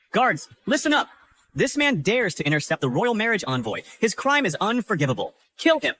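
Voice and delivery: high, urgent call to the guards; commanding order. Acted. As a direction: order the troops to attack. Villain orders guards to attack.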